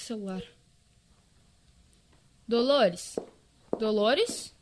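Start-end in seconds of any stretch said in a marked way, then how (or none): 2.5-4.5 calling out. Acted. Calling for a missing friend.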